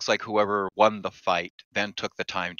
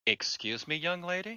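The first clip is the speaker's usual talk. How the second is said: character voice; 11 semitones higher, 5 dB quieter than usual. Acted. GM voicing character.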